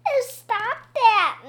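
high, drawn-out ticklish protest; giggly. A narrator's reading. Missus Roopy being tickled by a pupil, giggly. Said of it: "Missus Roopy giggled".

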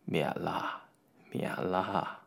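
put-on very low, soft elderly voice; gentle, feeble old man's murmur; old gentleman. A narrator's reading. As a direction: wave it off quietly, deep and hushed. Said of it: "回应道".

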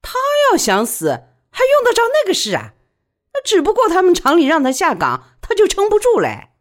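loud, high-pitched contempt; scornful sneer. A narrator's reading. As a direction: scoff at it with a cold sneer. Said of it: "李宝莉冷笑道".

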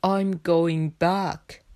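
slow, deliberate little bear; Bear character voice. A narrator's reading. Bear tells anxious Frog he is leaving, slow and steady. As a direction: say it slowly and simply as Bear.

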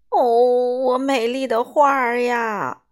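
loud, drawn-out theatrical lament; hammed-up storybook grief. A narrator's reading. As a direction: wail slowly over a ruined treasure. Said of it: "米歇尔悲叹道".

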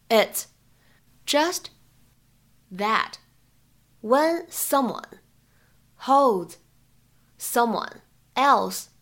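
slow word-by-word reading with pauses. Shown as a demonstration. Teacher splits the line word by word for students. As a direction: break the line into single words. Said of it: "英文台词当中呢，我们需要注意哪些发音技巧".

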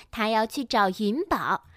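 ordinary delivery

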